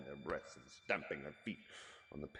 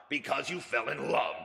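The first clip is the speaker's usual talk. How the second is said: growled; 7 semitones higher, 6 dB louder than usual. Acted. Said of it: "growled Scrooge".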